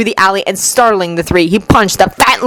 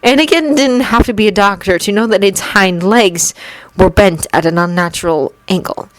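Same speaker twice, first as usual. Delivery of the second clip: ordinary delivery, nothing marked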